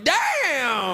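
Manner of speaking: high, long-held exclamation; mock amazement. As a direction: imitate a visitor showing off borrowed slang. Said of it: "He'll be go back home going".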